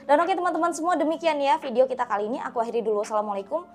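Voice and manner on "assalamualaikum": normal talking voice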